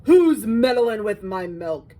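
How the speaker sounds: loud, slow indignant shout; storybook shout, drawn out. A narrator's reading. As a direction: bellow it slowly as the outraged milkman. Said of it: "he shouted".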